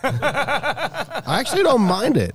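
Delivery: laughing. Real, not acted. Repeats verdict amid laughter.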